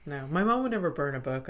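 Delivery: ordinary talk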